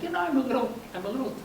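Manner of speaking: tentative, softened and lifted; hesitant. Retold or imitated. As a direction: gently quote a hesitant wife. Wife hesitantly voicing mild discomfort.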